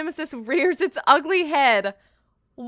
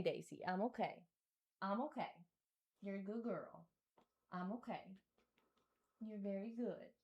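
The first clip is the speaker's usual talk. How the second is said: much softer and lower, calming; soft self-soothing; 7 semitones lower, 19 dB quieter, faster than usual. Real, not acted. Gamer soothing herself and her dog Daisy, very soft. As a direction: comfort yourself and the dog quietly.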